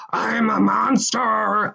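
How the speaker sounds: slow, silly monster growl; funny monster voice. Retold or imitated. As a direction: ham it up as a picture-book monster. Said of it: "like, a funny monster voice".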